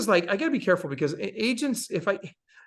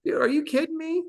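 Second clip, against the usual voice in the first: mock outrage; 11 semitones higher than usual. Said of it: "She's all mad, all pissed off".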